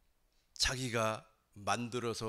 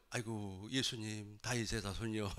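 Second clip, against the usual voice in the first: low, hushed, deflated call; acted meek, dispirited plea; cowed, small voice; 3 semitones lower, 6 dB quieter than usual. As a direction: shrink back and call out timidly. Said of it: "이렇게 기가 죽어서 소리가 작아질 것 같죠".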